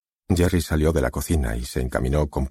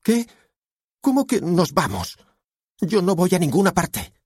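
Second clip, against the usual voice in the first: high-pitched, indignant daughter; teenage defiance, pitched way up; defiant warning; 3 dB louder than usual. A narrator's reading. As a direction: refuse in a high, outraged voice. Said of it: "avisó ella".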